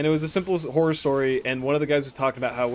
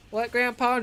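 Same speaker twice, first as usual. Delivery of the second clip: lightly voiced young boy, much higher; little kid voice; 10 semitones higher than usual. Seven-year-old asking grandpa, in a high kid voice.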